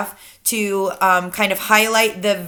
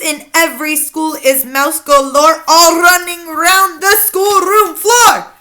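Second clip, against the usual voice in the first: raised, emphatic villain verse; gloating Grand High Witch; 7 semitones higher, 7 dB louder, slower than usual. Acted. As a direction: chant the rhyme with growing relish. Witch's gloating rhyme continues.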